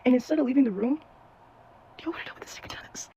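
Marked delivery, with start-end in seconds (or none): 2.0-3.1 hushed, conspiratorial whisper; dramatic whisper. Real, not acted. Storyteller teases his next move to viewers, conspiratorial whisper. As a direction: whisper it like a scandal being revealed.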